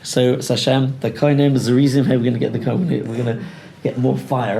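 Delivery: ordinary talk, nothing marked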